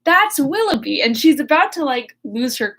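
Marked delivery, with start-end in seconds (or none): 0.0-1.0 shocked. Retold or imitated. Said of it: "Marianne's like".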